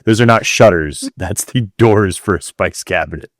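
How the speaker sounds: normal talking voice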